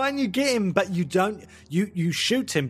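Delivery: usual speaking voice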